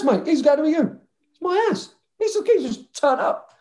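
high excited retelling of the party house; excited storytelling. Real, not acted. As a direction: tell it buzzing with disbelief.

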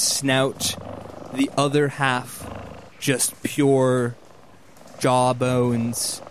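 slow, suspenseful reveal with long pauses; game master's scene setting. Acted. Game master unveils a creature to players, slow narration. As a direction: let each piece of the monster land separately.

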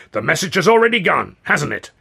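high, loud gloating; triumphant. A narrator's reading. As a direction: crow over a done deed. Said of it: "Considine cried triumphantly".

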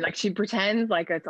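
ordinary talk, nothing marked

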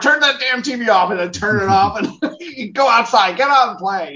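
high, loud exasperated parent yelling; scolding parent. Retold or imitated. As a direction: bark at the kids to go outside. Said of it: "It's like".

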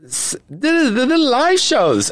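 put-on high, slow hesitant German-accented voice; lightly mocking Heidi Klum announcing; imitating Heidi. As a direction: mimic a judge fumbling English slowly. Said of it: "she says".

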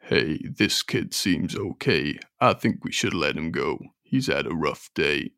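very low, soft, gravelly rocker; deep [name] voice. Acted. [name] voice again.

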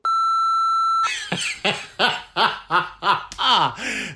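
long, high-pitched cackling laugh; laughter. Real, not acted. Host cracking up at a caller's cheeky message, laughing. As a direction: burst out in helpless high laughter.

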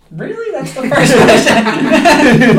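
mock outrage at a silly question; incredulous. Retold outrage at the owner.

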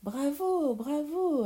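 high, drawn-out bravos; swooping, admiring cry; applauding cheer. Acted. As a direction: cheer them on like a delighted audience. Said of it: "applaudit Pierre".

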